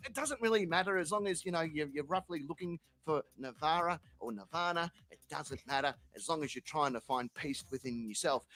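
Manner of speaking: low, soft and calm; softly calming zen gardener; soothing zen voice. Pretend. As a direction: soothe him like a serene monk. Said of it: "they'll be like".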